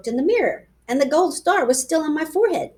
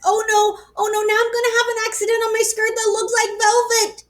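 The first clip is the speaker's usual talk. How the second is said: panicky; 10 semitones higher than usual. A narrator's reading. Girl panics about an accident.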